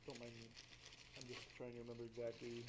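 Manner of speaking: ordinary talk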